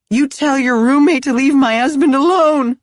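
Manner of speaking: high, loud, furious scream. Acted. Drunk wife screaming at Kenzie, enraged yell. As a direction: scream it through the door. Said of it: "the woman screamed at Kenzie".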